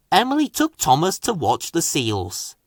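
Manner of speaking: plain reading voice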